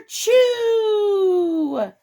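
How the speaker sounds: huge high shouted kerchoo; big sneeze. Pretend. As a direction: belt out the sneeze with the kids. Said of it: "Should we all say it together?".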